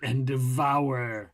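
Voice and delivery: "Endevour" drawn out slowly, low, with a "vour" ending; slow, mock-posh "Endevour". Shown as a demonstration. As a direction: savour the word Endevour. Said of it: "you spell endevour".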